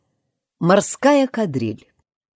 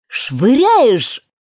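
high, loud cry, leaping; shout; 11 semitones higher, 6 dB louder, much slower than usual. A narrator's reading. Gryphon jumping and shouting the next figure. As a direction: fling the words out. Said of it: "крикнул Грифон".

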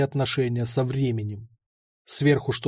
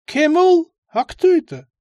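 put-on a higher, croaky-free old man calling out; old man's voice; 13 semitones higher, 6 dB louder than usual. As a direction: voice the old stoner asking back.

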